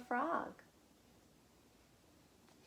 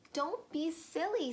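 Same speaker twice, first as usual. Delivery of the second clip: mild scolding; 8 semitones higher, 3 dB louder than usual. Acted. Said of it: "said Frog".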